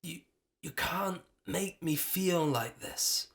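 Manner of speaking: strained, slow, halting words; struggling to speak. A narrator's reading. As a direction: force each word out. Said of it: "struggling to get the words out".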